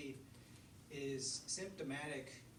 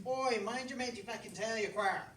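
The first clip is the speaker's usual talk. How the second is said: higher, louder pub banter; cheeky fox; 7 semitones higher, 8 dB louder than usual. Acted. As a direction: rib the lads like a loud sly fox.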